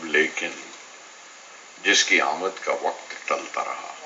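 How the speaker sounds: measured verse with long pauses; poetry recitation. Acted. Poet recalls days with Pancham to an audience, reflective poetry recitation.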